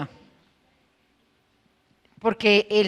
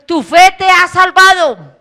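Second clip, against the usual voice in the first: proclaiming; 7 semitones higher, 10 dB louder, slower than usual. Acted. Lord declares to woman.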